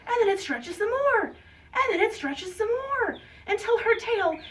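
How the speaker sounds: high, animated build to the climax; excited, high-pitched story narration; children's storytelling. A narrator's reading. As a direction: stretch the suspense for young listeners.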